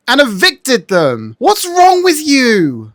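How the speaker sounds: slow, drawn-out indignant scolding; exasperated outrage, pitch climbing high; outraged. Real, not acted. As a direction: scold in disbelief, stretching each word. Outraged at orphanage eviction.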